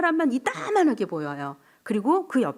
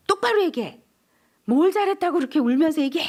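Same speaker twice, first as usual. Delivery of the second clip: high, louder parental telling-off; mock scolding; 6 semitones higher, 4 dB louder than usual. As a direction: bark at a crying child. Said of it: "이렇게 얘기". (아이를 꾸짖는 높고 큰 목소리)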